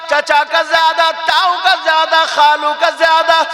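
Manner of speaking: very high, loud, drawn-out declamation; full-force preaching. Real, not acted. Preacher proclaiming Islam's ruling to the sisters, full-force preaching. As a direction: proclaim it slowly at a shout, stretching the words.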